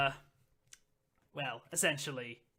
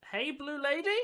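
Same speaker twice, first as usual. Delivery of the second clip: put-on high, questioning young elf; tentative repeat; 9 semitones higher than usual. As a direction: repeat the call as an unsure question. Young elf repeats his call, unsure.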